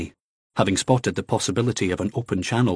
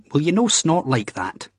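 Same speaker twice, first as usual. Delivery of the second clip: annoyed; 7 semitones higher than usual. A narrator's reading. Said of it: "he said, annoyed".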